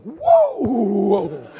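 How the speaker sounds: loud, high startled yelp; startled whoa. Acted. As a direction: jump back and yelp. Snake flung at Quentin.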